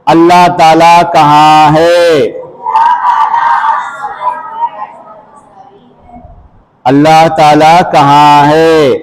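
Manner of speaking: put-on drawn-out question called loudly to the children; loud call to class. Shown as a demonstration. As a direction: call it out slowly for the kids to answer. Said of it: "बच्चा जवाब दो".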